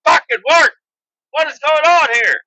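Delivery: high, loud shout at the car; frustrated yell. Retold or imitated. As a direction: scream at the taillights to work. Frustrated in dream.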